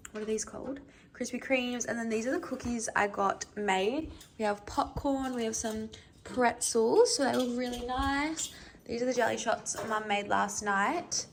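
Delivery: soft, calm show-and-tell narration; walk the camera through the spread; vlog voiceover. Acted. Quiet vlog voiceover of food.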